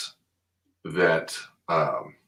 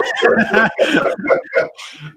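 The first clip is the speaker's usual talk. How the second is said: high laugh; 6 dB louder than usual. Real, not acted. Telling the host about a first live stream, high laugh. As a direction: laugh up high, almost without voice.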